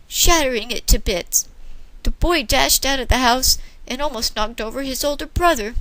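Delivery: plain reading voice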